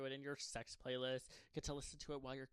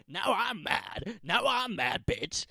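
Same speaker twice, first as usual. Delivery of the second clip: screamed, very slow and much higher; hammed-up scream; 9 semitones higher, 20 dB louder, much slower than usual. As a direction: scream it out as a fake tantrum.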